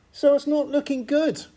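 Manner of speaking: pitched well up, mock-dramatic dismay; mock gloom. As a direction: play the doom for a laugh, high and theatrical. Preacher sums up the bleak situation.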